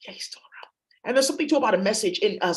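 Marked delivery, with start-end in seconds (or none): 0.1-0.7 very soft whisper; whispered aside. Real, not acted. Private aside amid the reading.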